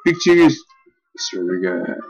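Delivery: ordinary delivery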